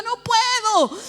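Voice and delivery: high, loud, final protest; definitive refusal. Retold or imitated. Preacher turning down the calling, definitive refusal. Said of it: "ya en forma definitiva".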